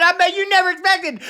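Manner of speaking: high cackling laugh. Real, not acted. [name] laughing at his surprise shout-out. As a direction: burst out laughing mid-brag.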